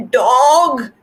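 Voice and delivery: loud, high, drawn-out call; a storybook wail for help. A narrator's reading. Cat rushes home to wake Dog, wailing. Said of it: "Cat wails".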